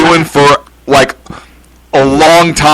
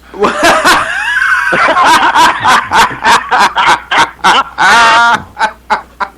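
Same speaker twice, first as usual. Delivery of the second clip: long laugh; 8 semitones higher than usual. Real, not acted. Co-host laughing at news.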